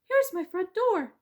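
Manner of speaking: high, loud tricky mouse. Acted. Mouse tricking the bees, high and sly.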